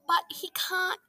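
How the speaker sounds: ordinary delivery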